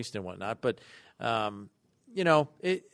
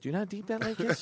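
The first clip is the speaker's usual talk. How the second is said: lecturing dad voice; 9 semitones higher, 3 dB quieter than usual. Retold or imitated.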